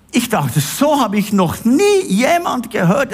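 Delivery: ordinary delivery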